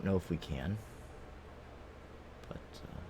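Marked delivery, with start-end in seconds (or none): 2.5-3.0 whispered aside. Real, not acted. Hushed aside while testing swap.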